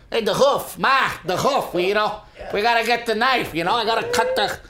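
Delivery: high, fast movie mobster voice; Joe Pesci. Acted. Husband doing a Goodfellas bit for his wife, terrible Pesci impression. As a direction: badly imitate an agitated movie tough guy. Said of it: "such a terrible Joe Pesci impression".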